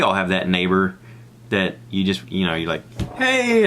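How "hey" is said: high-pitched mock hello; friendly greeting. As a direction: call out a hearty hey. Acts out greeting a neighbor.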